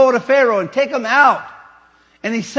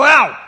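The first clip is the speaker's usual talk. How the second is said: "Out" barked louder and higher as a command; forceful out; 5 semitones higher, 8 dB louder than usual. Shown as a demonstration. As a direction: snap "Out" as an order. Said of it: "three different meanings".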